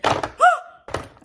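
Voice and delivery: high, loud exaggerated gasp; mock gasp. Coworker gasping at the full water bottle. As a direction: gasp in fake shock.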